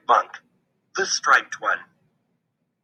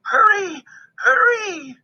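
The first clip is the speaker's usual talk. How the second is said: high, loud urgent call; 3 dB louder than usual. A narrator's reading. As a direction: shout for him to hurry. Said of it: "she called".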